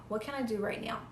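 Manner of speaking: a hushed inner question; very quiet. Shown as a demonstration. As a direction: ask it softly, inward and still. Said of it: "get so still".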